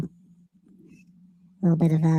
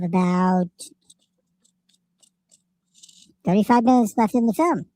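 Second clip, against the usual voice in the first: helium voice. Pretend. Streamer on a helium effect while cohost is gone. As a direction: talk through a chipmunk filter. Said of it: "I'll just talk like this".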